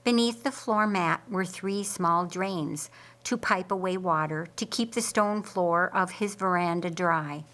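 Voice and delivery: ordinary delivery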